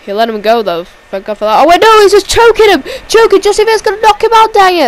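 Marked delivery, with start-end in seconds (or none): 1.6-4.8 high, loud, rushed alarm; frantic play-by-play. Real, not acted. Commentator seeing Josh T V get choked, frantic shout. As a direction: cry out the choke as it happens. Reacting to a choke hold live.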